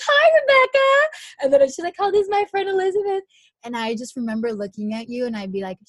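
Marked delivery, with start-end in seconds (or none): 0.1-1.1 very high, loud mock hello; squealed, sing-out hello; chirpy greeting. Imitates the coach's greeting on arrival. 2.0-3.2 high, loud imitation of an introduction; excited high-voiced presenting of a friend; chirpy introduction.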